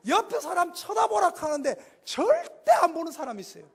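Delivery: normal talking voice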